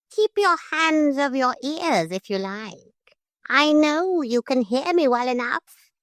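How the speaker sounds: high, prim, threatening parrot; calm menace from a small bird. A narrator's reading. Parrot coolly threatening the lady in the veil.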